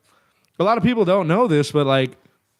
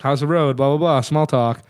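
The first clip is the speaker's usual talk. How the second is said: normal talking voice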